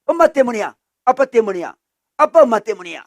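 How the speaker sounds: loud, high accusing bursts; resentful blaming. Acted. Child of divorced parents blaming them, loud resentment. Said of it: "원망을 하게 됩니다".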